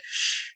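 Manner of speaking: "sh" as a bare hushing consonant; SH sound. Shown as a demonstration. Teacher showing how S can sound, a bare "sh". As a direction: draw out "sh" with no vowel after it. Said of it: "S can also sound".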